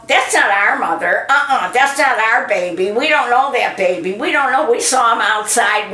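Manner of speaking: put-on the kids protest they know nobody here; mock panicked denial. Children fleeing the theater, disowning mother and baby in mock panic.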